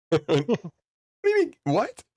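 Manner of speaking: high, startled question; surprised what. Retold or imitated. Teller recalls hearing the scam came from his hometown, high surprised squeak. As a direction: squeak it out in disbelief.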